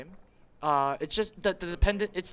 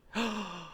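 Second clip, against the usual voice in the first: shocked gasp; 4 semitones higher, 6 dB quieter than usual. Real, not acted. Shocked gasp at a game turn.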